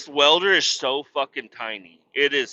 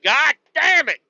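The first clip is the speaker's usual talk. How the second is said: much higher and louder, fed-up outburst; exasperated curse; 7 dB louder than usual. Retold or imitated. Pulled away from his project to shovel, exasperated loud curse. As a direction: blow up at being interrupted again. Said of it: "I'm like".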